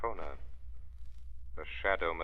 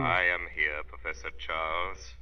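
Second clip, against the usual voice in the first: eerie unseen voice; 4 dB louder than usual. Acted. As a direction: speak as a voice from the shadows. The Shadow as unseen voice.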